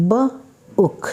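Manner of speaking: book split into b and ook; sound-by-sound demo. Shown as a demonstration. Teacher sounding out "B-ook" for students. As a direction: sound out "B-ook" slowly, b then ook. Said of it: "It is not book, it is book".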